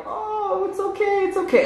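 very high, soft comforting coo; mock soothing. Party kids to crying child, high soft mock comforting. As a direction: imitate kids consoling in a tiny high voice.

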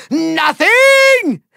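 usual speaking voice

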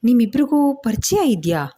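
puzzled, asking why both cry; surprised son. A narrator's reading. Karna finds mother and stranger weeping, surprised. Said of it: "ಕರ್ಣನಿಗೆ ಆಶ್ಚರ್ಯ".

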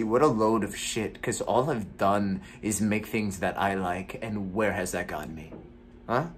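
a jaded actor venting alone; gloomy. Acted. Actor performs a bitter monologue.

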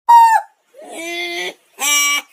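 squeaky high-pitched noises; helium voice. Real, not acted. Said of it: "Tourette's on helium".

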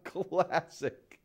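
high laugh into a fond remark; laugh, then savour the memory; amused chuckling. Acted. Host chuckling over an old prank on a colleague, amused.

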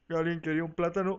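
normal talking voice

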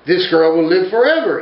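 loud, high, furious outburst; a god's angry proclamation; angry cry. Acted. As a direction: cry it out in rage at the girl's fate. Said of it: "he cried".

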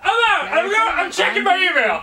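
high-pitched, laughing outrage; worked-up mock rage-quit; exasperated rant. Real, not acted. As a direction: rant helplessly through laughter. Rage-quits the game, laughing.

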